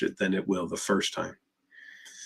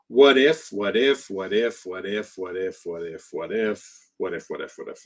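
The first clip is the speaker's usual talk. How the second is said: voice of OCD, fading. Retold or imitated. OCD voice looping doubts.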